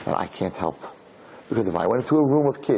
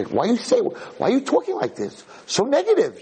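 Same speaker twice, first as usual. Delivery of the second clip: high, hammed-up dismay; acted motherly protest at such gloom; scolding mother; 11 semitones higher than usual. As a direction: chide her on a raised pitch. Mother scolds girl's negativity.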